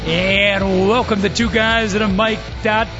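upbeat radio host show opener; sports talk host. Acted.

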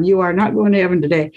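firm, quick, no-nonsense nurse. Retold or imitated. Nurse firmly telling the patient she stays, quick and stern. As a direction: lay down the law, briskly. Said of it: "very firm".

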